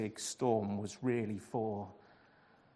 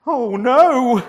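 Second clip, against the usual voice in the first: high, very loud wailing groan; 10 semitones higher, 17 dB louder than usual. A narrator's reading. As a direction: howl in dismay. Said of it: "Jonah groaned".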